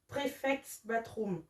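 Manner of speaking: said quietly and slowly in a mock English accent; self-mocking English accent. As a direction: try an English accent on the title, half-joking. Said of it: "Avec mon super accent".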